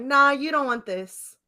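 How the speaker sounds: smug mock refusal; dismissive. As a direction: wave him off with a smug nah. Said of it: "I'm like".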